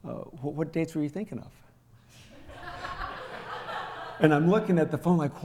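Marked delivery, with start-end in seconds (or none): 0.0-1.5 calm monotone. Retold or imitated. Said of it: "came down to monotone conversation". 2.6-4.2 soft, airy burst of laughter; real laugh. Real, not acted. Laughs at brother's turnaround.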